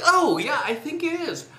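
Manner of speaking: a gleeful kid playing along, high; imitating his younger self. Boy agreeing with his brother at dinner, high mock voice. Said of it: "I'd be like".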